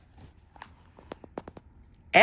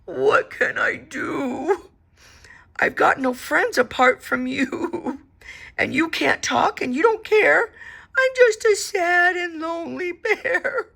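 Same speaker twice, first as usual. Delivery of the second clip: crying bear; 9 semitones higher than usual. A narrator's reading. The lonely bear cries to his teddy, tearful. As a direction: cry out your loneliness. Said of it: "started to cry".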